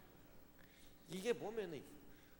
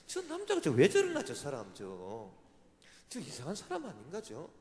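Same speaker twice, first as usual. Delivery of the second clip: mock bewilderment; 2 semitones higher, 7 dB louder than usual. Quoting his own bewildered thought.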